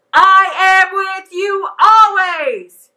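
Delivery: loud, high and slow, stopping short of a scream; really loud. Shown as a demonstration. Children's leader asks the kids for the verse really loud, no screaming. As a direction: belt out I am with you always. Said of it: "I want you to say it really loud".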